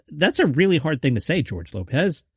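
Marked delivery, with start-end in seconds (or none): none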